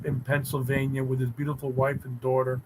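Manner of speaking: ordinary talk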